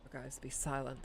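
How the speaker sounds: normal talking voice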